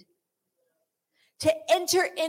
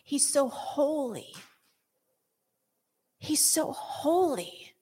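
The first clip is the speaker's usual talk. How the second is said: awed hush; 5 semitones higher, slower than usual. Pretend. Believer imagining worship, whispering in awe. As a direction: breathe it out in awe.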